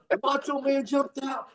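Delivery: the fan recounting the match with awe; eager fan. Retold or imitated. Fan voiced in story.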